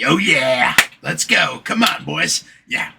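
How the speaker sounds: rally the crew like a pumped coworker; mock hype.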